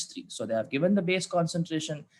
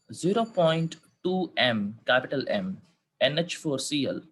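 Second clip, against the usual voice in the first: slow school dictation. Shown as a demonstration. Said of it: "I repeat the question".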